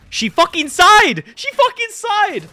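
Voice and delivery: high, loud, drawn-out yell; panicked outrage at a sigh; outraged exclamation. Retold or imitated. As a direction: squeal it slowly in disbelief.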